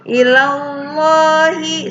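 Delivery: "Ilallahi" with a heavy, full l; tafkhim. Shown as a demonstration. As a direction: chant "Ilallahi" high and slow. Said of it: "ini ada tafhim".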